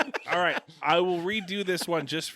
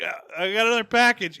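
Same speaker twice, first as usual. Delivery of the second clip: hurried, raised voice, announcing a parcel; lightly mock a parent calling out; put-on parent, quick and louder; imagined parent; 7 semitones higher, 8 dB louder, faster than usual. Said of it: "and they're like".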